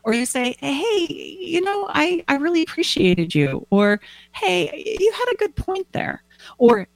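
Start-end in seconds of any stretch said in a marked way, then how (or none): 0.6-3.7 high, hesitant, slightly gushing thanks; acted tender confession in a raised voice; heartfelt appreciation. Acting out emotional appreciation. 4.3-6.2 high, light, encouraging praise; acted compliment in a raised voice; friendly compliment.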